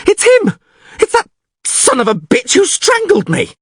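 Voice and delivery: very high, frantic accusation; panicked yell. A narrator's reading. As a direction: point out your strangler in alarm. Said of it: "yelled Dandelion, grabbing his throat".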